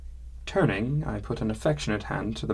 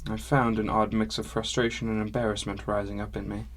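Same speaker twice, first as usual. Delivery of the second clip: plain reading voice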